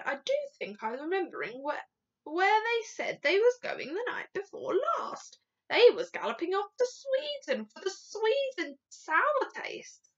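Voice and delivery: recollecting giant. A narrator's reading. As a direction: recall it aloud as the giant. Giant recalls for the Queen.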